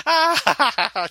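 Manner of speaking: high stifled chuckle, imitated; Diane's chuckle. Imitating Diane trying not to laugh at Harry's joke, high chuckle. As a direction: mimic a giggle she can't hold in. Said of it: "just like this chuckle".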